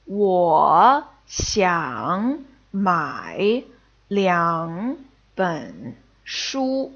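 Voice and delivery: 我想买两本书 read word by word, very slowly. Shown as a demonstration. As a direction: crawl through each syllable. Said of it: "look at this example sentence".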